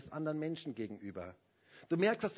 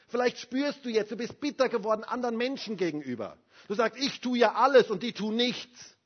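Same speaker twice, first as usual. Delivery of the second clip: louder, higher pleading sermon; impassioned pastor, louder and higher; fervent preaching; 6 semitones higher, 6 dB louder than usual. Acted. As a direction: preach it out to the congregation. Preaching at full force.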